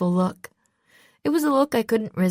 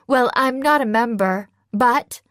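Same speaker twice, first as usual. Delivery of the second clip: hurried, a bit louder; quick; 5 semitones higher, 4 dB louder than usual. A narrator's reading. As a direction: rush it out before he can interrupt. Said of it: "I said quickly".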